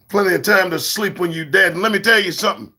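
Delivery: normal talking voice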